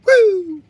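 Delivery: loud falsetto whoop; high whoop. Real, not acted. Whooping after screaming at the scanner, high whoop. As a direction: let out a piercing "woo".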